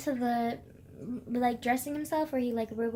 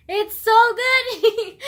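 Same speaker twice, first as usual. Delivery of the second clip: much higher, louder and very slow; excited gush; 8 semitones higher, 13 dB louder, much slower than usual. Real, not acted. Gushing to viewers about a favourite book. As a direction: burst out with delight over the book. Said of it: "yeah, it's so good".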